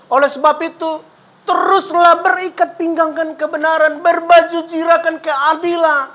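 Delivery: high, sustained preaching cry; scripture exhortation. Real, not acted. Preacher urging congregation to gird on truth, fervent exhortation. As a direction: ring the verse out to the congregation, high and urgent.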